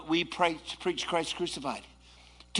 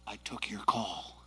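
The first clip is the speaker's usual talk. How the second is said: hushed answering voice; 12 semitones lower, 10 dB quieter than usual. Pretend. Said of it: "did you pick up the line and go".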